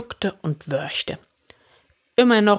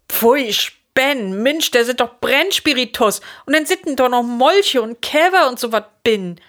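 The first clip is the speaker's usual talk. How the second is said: tag; 7 semitones higher, 5 dB louder than usual. A narrator's reading. Disgusted at drinking preserving spirit.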